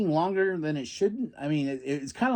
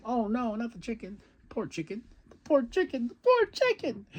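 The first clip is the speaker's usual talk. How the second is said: mock lament; 6 semitones higher, 3 dB quieter than usual. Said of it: "Bryan Danielson's like".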